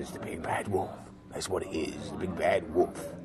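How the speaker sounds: low, soft growly wolf voice; Big Bad Wolf voice. Dad doing the Big Bad Wolf to scare his toddler, low and soft. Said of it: "I still do this voice for her".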